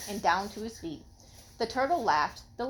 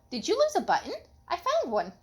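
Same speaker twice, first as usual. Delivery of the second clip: sparrow voice; 8 semitones higher than usual. Acted. Sparrow voiced in read story.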